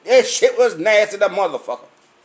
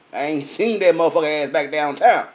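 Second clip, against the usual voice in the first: ordinary talk, nothing marked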